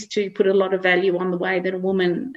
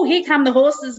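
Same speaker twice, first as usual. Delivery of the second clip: mock excited alert; 6 semitones higher, 3 dB louder than usual. Dog telling owner the horses are coming, playfully excited. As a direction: lightly voice an excitable dog's alert.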